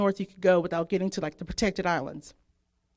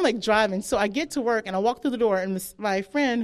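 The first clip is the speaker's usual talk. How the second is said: ordinary delivery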